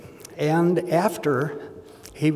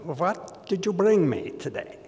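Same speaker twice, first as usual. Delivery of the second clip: doctor's greeting; 2 semitones higher, 3 dB quieter than usual. Retold or imitated. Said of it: "he looked at me and he said".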